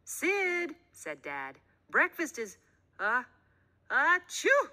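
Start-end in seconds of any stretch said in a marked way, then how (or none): 0.0-0.8 high loud call of the name. A narrator's reading. Dad calling son. 1.9-4.7 halting words that burst into a loud sneeze; building up to a sneeze. A narrator's reading. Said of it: "dad sneezes mid sentence".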